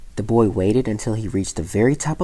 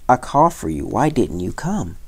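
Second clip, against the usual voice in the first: ordinary delivery, nothing marked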